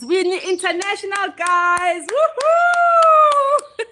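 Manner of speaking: high, loud, drawn-out woohoo at the end; excited cheer. Real, not acted. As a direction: build into a jubilant whoop. Cheering her international audience.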